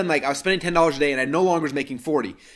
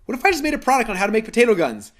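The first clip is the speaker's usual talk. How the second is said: higher, louder sudden brainwave; excited idea; 9 semitones higher, 6 dB louder than usual. Retold or imitated. Remembering his idea for a product, excited and louder. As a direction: sell the thought like a lightbulb moment. Retold lightbulb thought.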